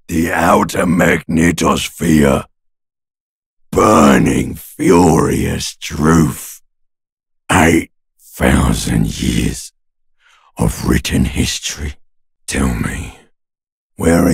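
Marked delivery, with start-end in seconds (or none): 0.1-12.0 grand proclamation. Acted. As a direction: proclaim it like a burning revelation. Grand proclamation within the monologue.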